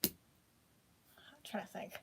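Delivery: soft breathy aside, half thinking aloud; whispered muttering; whisper to herself. Real, not acted. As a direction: drop to a whisper while gathering thoughts.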